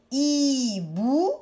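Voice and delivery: "hibou" drawn out in two syllables; slow "hibou", syllable by syllable; split into syllables. Shown as a demonstration. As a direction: stretch each syllable of "hibou". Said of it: "Je vais prendre la syllabe".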